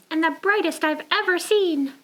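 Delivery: high little penguin voice. Acted. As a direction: compliment as the penguin. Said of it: "said Penguin".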